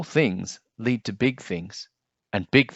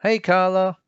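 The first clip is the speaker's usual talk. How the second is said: friendly greeting; 8 semitones higher, 7 dB louder than usual. Acted.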